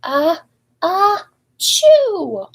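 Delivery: high squeaky ah-ah-chew; baby sneeze. Acted. Baby elephant sneezing in front of the herd, high and squeaky. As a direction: build up and burst into a sneeze. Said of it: "the baby sneezed".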